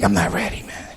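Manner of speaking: reluctant mumble. Retold or imitated. Young comic dodging the pushy brother, low mumble. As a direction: mumble it away, not ready.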